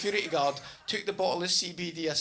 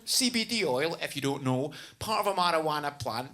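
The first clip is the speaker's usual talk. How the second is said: normal talking voice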